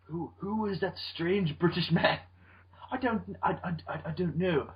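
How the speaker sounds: put-on a lightly done, quiet British-Japanese accent; a fumbled mix of British and Japanese accents. Ken's twin on set, quiet British-Japanese accent. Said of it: "weird cross between British and Japanese I just did".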